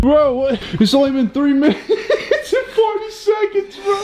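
high laughing disbelief. Real, not acted. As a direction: laugh through the words. Laughing at elapsed time.

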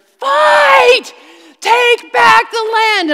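high, loud divine battle cry; commanding shout. Retold or imitated. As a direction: roar the command to charge. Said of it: "I just heard".

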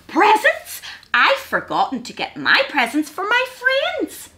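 high, swooping hedgehog voice; high-pitched storybook panic; squeaked dismay. A narrator's reading. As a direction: wail it lightly like a worried little creature. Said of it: "squeaked little Hedgehog".

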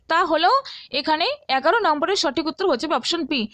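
usual speaking voice

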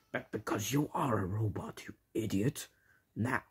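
soft, otherworldly henchman voice; quiet villain voice. Acted. Villain sidekick voice.

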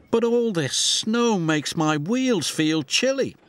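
high, complaining engine voice; whiny little engine. Acted. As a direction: whine about the cold. Oliver complains about the cold.

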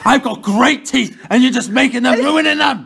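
loud, rising exasperation; indignant rant. Real, not acted. Accusing a mate of ruining great teeth, indignant rant. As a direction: protest loudly, pitch climbing to the end.

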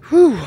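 a high, loud blown-out whew; breathless whew. Real, not acted. Vlogger at the top after the climb, blowing out a loud whew. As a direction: exhale hard, spent from climbing. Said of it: "I'm out of breath".

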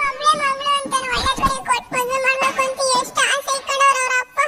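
crying plea. Acted. Mother pleads over eloped daughter.

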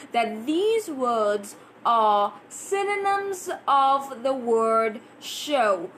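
slow, deliberate teacher repeat; slow each word down for the class. Shown as a demonstration. Teacher slowing down so students can follow, slow. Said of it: "I'm just gonna slow down".

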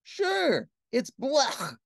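high, sweet little princess; dainty royal daughter voice. A narrator's reading.

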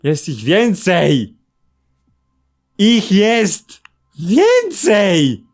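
dragged-out mock wail; slow drawn-out. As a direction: stretch every word slowly. Repeated line, dragged out. (powolny, przeciągły jęk)

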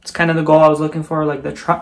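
ordinary delivery

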